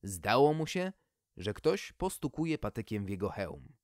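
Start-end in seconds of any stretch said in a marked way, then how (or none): none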